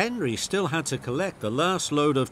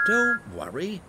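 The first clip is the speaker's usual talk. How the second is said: higher, chirpy reassurance; a light higher whistle-like reply; whistled; 7 semitones higher than usual. A narrator's reading. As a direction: toot it out to calm Henry. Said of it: "Thomas whistled".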